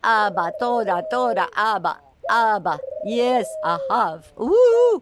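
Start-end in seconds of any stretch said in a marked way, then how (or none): 4.4-5.0 high, loud cheer; joyful whoop. Real, not acted. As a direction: let out a delighted whoop. Cheering the bird.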